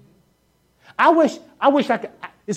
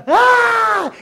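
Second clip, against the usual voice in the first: put-on child's scream; 12 semitones higher, 4 dB louder than usual.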